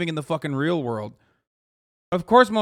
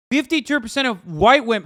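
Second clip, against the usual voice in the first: louder, stressing Fifty-two; emphatic repeat; 5 semitones higher, 6 dB louder than usual. Real, not acted. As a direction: hammer the figure home.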